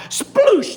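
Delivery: high, loud splash word; vivid splash imitation. Gators hitting the swamp water, loud high splash noise. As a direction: burst out with a big splash.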